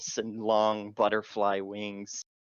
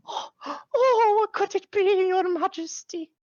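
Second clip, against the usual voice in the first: very high, eager little fairy; excited fairy voice; 7 dB louder than usual. Acted. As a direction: squeal it up high with delight.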